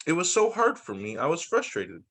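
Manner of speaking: ordinary delivery, nothing marked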